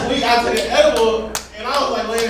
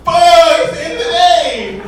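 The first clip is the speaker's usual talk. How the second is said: loud, high mock-despairing outburst; loud panicked curse; 10 semitones higher, 11 dB louder, slower than usual. Comedian forgets anniversary, shouts in mock despair. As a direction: yell it like doom just hit.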